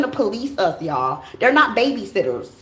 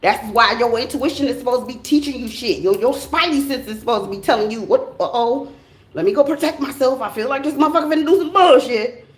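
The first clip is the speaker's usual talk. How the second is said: usual speaking voice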